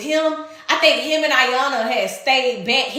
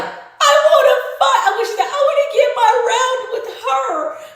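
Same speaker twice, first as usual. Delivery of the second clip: mock the crying fighter, pitched way up; retold sob-yell; 12 semitones higher than usual. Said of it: "And she's like".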